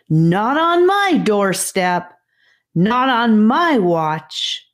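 a small hero stating it plainly; confident little voice. A narrator's reading. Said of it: "a confident little voice called out".

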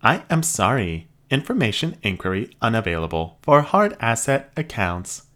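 high, even robot voice; polite machine receptionist. Acted. Robot refuses.

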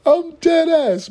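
very high, slow, through laughter; squeaking it out mid-laugh; laughing squeal. Real, not acted. Laughing after the smell story.

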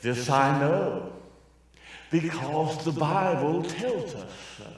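slow, softened, mock-eloquent preacher; hushed and measured, parodying polite preaching; eloquent demo. Shown as a demonstration. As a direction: demonstrate a genteel sermon with long, careful pauses. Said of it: "said that more eloquent".

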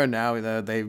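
usual speaking voice

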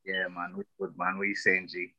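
ordinary talk, nothing marked

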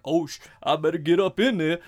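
hammed-up eager guy barging in; entitled line cutter. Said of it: "and he's like".

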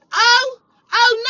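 high, loud, scornful "oh"; mocking echo. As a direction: throw his word back at him. Mocks the ex's only response.

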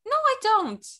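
high, loud protest; incredulous denial. Real, not acted. Host told of a British accent, incredulous. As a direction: deny it in loud disbelief.

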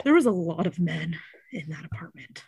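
whispered aside about the men; secretive whisper to a friend; hushed aside. Real, not acted. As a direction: drop to a whisper as if they could hear. Gossiping about the crowded apartment.

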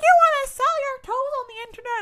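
very high, loud imitation of outrage; hammed-up shrill incredulous question; scandalized disbelief. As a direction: squawk it in mock horror. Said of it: "He's like".

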